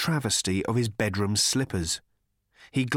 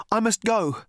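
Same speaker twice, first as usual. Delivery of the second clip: insistent; 12 semitones higher, 4 dB louder than usual. Acted. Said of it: "insisted Arthur".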